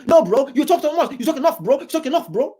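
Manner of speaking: high, loud mock tough-guy voice; high loud mocking bro voice; mocking muscle man. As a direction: ham up a bossy muscle man shouting them down. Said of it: "Mister Military muscle man telling them".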